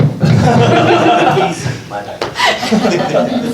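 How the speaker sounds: a burst of surprised, falling laughter; startled laugh. Real, not acted. Poet at the mic laughs at a thunderous noise, surprised laughter.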